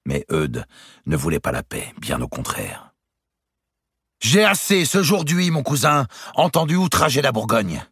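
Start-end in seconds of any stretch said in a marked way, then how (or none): 4.2-7.8 much higher and louder, full of wounded pride; the duke refuses to take another insult; Eudes's indignant outburst. A narrator's reading. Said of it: "dit-il".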